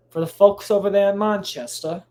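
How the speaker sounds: a half-hearted British accent, lightly put on; a quick, clumsy stab at a British accent. Mocking the Manchester fans in a weak British accent. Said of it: "my accent game is piss poor".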